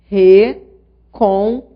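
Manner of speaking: Recompor split slowly into re, com, por, loud at the start; syllable by syllable. Shown as a demonstration. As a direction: sound out "Recompor" slowly with a gap after each syllable. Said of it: "vou dividir as sílabas da palavra".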